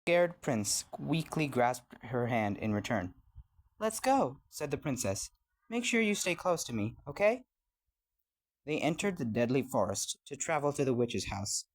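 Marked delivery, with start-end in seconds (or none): 3.8-4.4 high, lifted princess voice; eager princess. A narrator's reading. Princess urges the prince along. 5.7-7.4 high, lifted princess voice; eager princess. A narrator's reading. Princess looks after blind prince.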